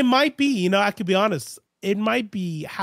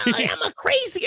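silly cartoon voice; 11 semitones higher than usual. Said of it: "this little silly".

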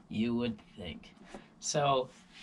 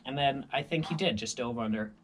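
usual speaking voice

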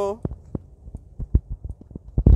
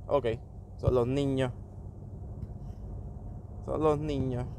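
ordinary delivery

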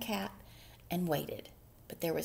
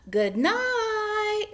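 high, loud, drawn-out mouse call; louder call; 13 semitones higher, 7 dB louder than usual. A narrator's reading. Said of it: "Mouse called a little louder".

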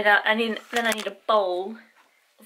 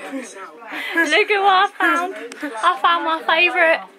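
laughing excited; 7 semitones higher, 5 dB louder, slower than usual. Real, not acted. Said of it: "repeats excited find".

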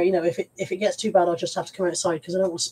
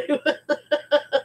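short laugh; 6 semitones higher than usual. Real, not acted. Laughs at viewer comment.

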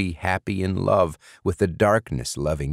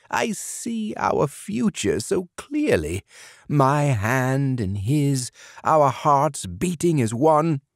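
much higher, slow, dreamy and tearful; tearful dreaming; 9 semitones higher, slower than usual. A narrator's reading. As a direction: swoon through the tears. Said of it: "Burgum cried".

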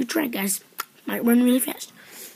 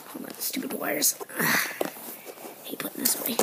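whispering grumbles under the breath; muttered whisper; 3 semitones lower, 11 dB quieter than usual. Real, not acted. Kid muttering while packing away stupid wires. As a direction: grumble quietly at the tangled wires.

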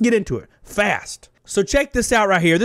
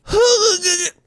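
sudden high laugh; 12 semitones higher than usual. Real, not acted. Laughing at his own joke.

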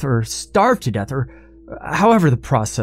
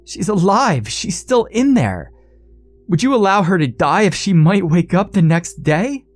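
narrated scream; 7 semitones higher than usual. A narrator's reading. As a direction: climb in pitch as the argument heats up. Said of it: "screamed Blaine".

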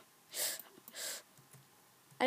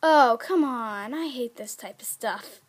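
ordinary talk, nothing marked

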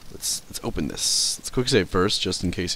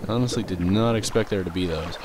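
normal talking voice

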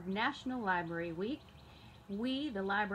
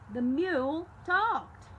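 high, slow, loud frightened voice; shaken farmer; 8 semitones higher, 7 dB louder, slower than usual. A narrator's reading. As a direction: blurt it out, still scared. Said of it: "he told his wife".

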